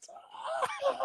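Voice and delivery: laughing it out again quietly. Real, not acted. Still laughing.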